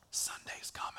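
hushed whisper, almost dreading it. Shown as a demonstration. Pastor whispers the weary way to see Sunday, hushed. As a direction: breathe it out like a chore.

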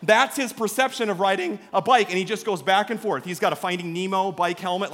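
normal talking voice

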